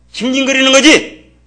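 loud, high, slow "징징거리는 거지!"; emphatic retort. Retold or imitated. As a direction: fire back "징징거리는 거지!" as a drawn-out shout. Retort to the retold question.

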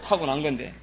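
ordinary talk

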